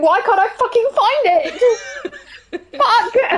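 high, loud, drawn-out frustration; exasperated wail. Real, not acted. Player lost in a tiny room to guide, exasperated wail. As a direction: wail it at the top of your range.

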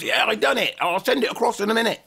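high-pitched, gleeful report; excited videographer. Retold or imitated. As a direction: imitate a friend thrilled with his finished work. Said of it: "He goes".

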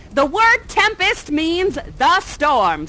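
ordinary talk, nothing marked

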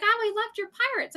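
high, fast, gushing fan; gushing readers. Retold or imitated. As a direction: mimic an excited reader raving, high and quick. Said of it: "They're like".

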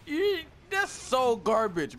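mocking. Real, not acted. Mocking teammate's score.

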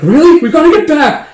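high, loud mock panic; acted urgent alarm; panicked. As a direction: blurt it in alarm, pitch way up. Acted panic at imam's arrival.

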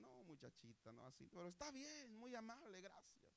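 soft, high, over-polite reply; restrained politeness. Retold or imitated. Customer answers the hairdresser, politely restrained. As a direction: imitate politely holding back annoyance.

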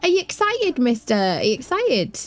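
high cooing question, easing down; excited teasing. Real, not acted. Player asking mister whether he is excited, high playful teasing. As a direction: pester him playfully, starting very high.

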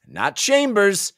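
pointed correction. Acted. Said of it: "Chambers pointed out".

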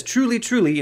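both TRULYs pitched well up; emphatic repetition. Retold or imitated. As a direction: lift "Truly, truly" high for emphasis. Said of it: "in order to emphasize it".

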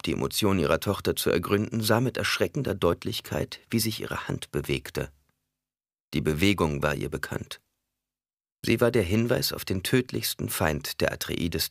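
ordinary delivery

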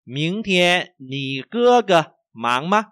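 slow, high, emphatic tone drill; language teacher model reading. Shown as a demonstration. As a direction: stretch each word for learners. Models sentence for learners. (đọc mẫu chậm, rõ thanh)

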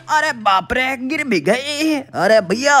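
ordinary delivery

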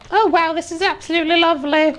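very high, sarcastic gushing; mock delight. Player mocking the chilly room, high sarcastic gush. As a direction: gush with fake joy.

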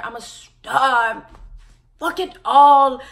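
drawn-out, loud, high declaration; hammed-up loud outburst; mock grand proclamation. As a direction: belt it like a diva quitting everything. Imagined dramatic declaration.

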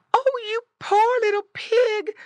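high, loud pitying cry; wailing mama pig sympathy; distressed mother pig. A narrator's reading. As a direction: fuss over your piglet in a high voice. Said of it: "grunted Missus Pig".

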